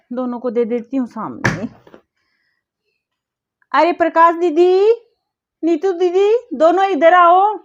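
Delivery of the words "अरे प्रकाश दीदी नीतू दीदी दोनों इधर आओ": calling out. Acted. Sister-in-law summons both women to come over, calling out. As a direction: call them over from another room.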